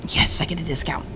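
gleeful whispered aside. Real, not acted. Player whispering her small victory. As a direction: whisper the win to yourself.

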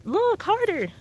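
high, sharp put-down; scolding. Real, not acted. To lazy shoppers, scolding them to look harder.

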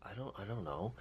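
quiet unsure mumble; barely audible, embarrassed; sheepish mumble. Retold or imitated. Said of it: "I'm just like".

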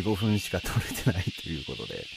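normal talking voice

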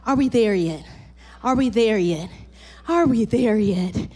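put-on low, whiny nagging kid; pestering child. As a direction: nag like a kid in the back seat. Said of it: "little kids on a road trip".

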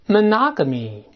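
"monogamy" stressed on its second syllable, pitch falling after. Shown as a demonstration. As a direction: lean on NOG in "monogamy". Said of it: "哎，重音在第二个音节". (示范“monogamy”，重读第二个音节)

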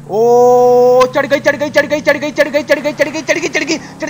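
high, fast excited chant of चढ़ गई; gleeful chant as the truck climbs; excited cheering. Real, not acted. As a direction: cheer the truck over the top.

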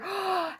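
sharp acted gasp of alarm; startled gasp. Narrator voicing Toad as the list blows away, startled gasp. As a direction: suck in a breath in alarm.

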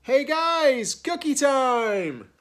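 high, loud holler with a long last word; calling out. A narrator's reading. Hippo summons friends for cookies, calling out. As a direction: call the whole gang over excitedly. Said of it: "Hippo calls out".

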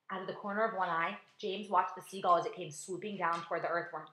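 ordinary delivery, nothing marked

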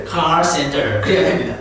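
ordinary delivery

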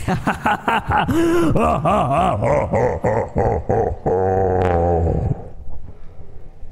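loud howling laughter. Real, not acted. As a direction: laugh until it almost turns to crying. Laughing at himself.

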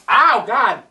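loud pained cry; pained outburst. Real, not acted. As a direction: cry out from the sting. Wiped nose with hot sauce.

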